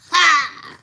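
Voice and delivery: loud, high exclaimed "ha!"; explosive preacher shout; preacher's "ha!". Pretend. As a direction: punch out a big pulpit "ha". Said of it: "preaching voice".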